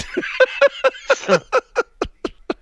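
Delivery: high breathless giggle, way up in pitch; real high-pitched laugh; high squealing laugh. Real, not acted. Laughing at the joke.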